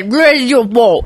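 badly faked Australian accent, drawn out; hammed-up Australian dad laying blame; mock Australian accent. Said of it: "But he's Australian".

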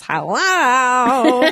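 loud, high intercom hello; intercom buzz voice. Shown as a demonstration. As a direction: announce yourself at the door. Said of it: "that's my intercom buzz voice is always".

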